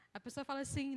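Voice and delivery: normal talking voice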